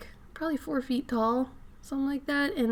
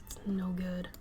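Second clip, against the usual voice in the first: breathy unvoiced whisper; 3 semitones lower, 8 dB quieter than usual. Real, not acted. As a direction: say it under the breath. Repeats phrase whisper then voiced.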